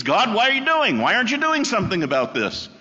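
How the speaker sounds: high, loud protest at God; exasperated prophet. Retold or imitated. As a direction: plead with God like a frustrated prophet. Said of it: "And he says".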